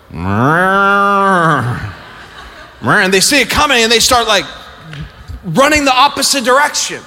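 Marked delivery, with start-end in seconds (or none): 0.1-3.0 low drawn-out mooing; cow moo. Retold or imitated. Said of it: "They go".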